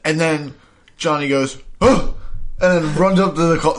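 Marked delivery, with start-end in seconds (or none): 1.8-2.1 high, loud startled "huh"; hammed-up gasp of shock; shocked mock gasp. Johnny reacts to car crash.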